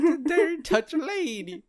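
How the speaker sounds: high, loud Southern belle shriek; over-the-top Southern accent. Retold or imitated. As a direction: camp Southern belle outrage. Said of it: "she goes full Southern".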